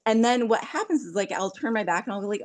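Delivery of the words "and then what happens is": ordinary talk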